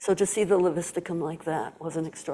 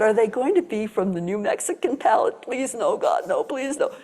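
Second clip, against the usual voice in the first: high, pleading mock dread; 4 semitones higher, 3 dB louder than usual. Teacher retelling her panic at the spiral, high mock pleading. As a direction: beg playfully not to have to name them. Dreading the herbs are local.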